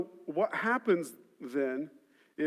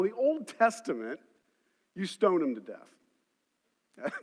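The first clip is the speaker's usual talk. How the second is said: ordinary talk, nothing marked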